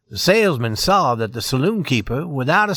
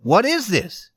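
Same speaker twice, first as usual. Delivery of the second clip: higher, puzzled and wondering; puzzled mystery; 6 semitones higher than usual. A narrator's reading. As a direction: ask it full of mystery. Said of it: "filled with mystery".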